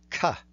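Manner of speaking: "ka" said as a plain unaspirated ka; Devanagari ka. Shown as a demonstration. Teacher reciting the guttural surd "ka". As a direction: say "ka" crisply with no extra breath. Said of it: "So we have".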